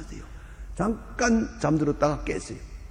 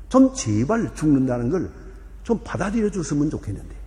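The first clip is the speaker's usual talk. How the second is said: ordinary talk, nothing marked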